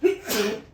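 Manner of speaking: sudden real sneeze; sneezing mid-sentence. Real, not acted. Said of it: "I just sneezed wicked".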